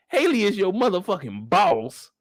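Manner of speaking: normal talking voice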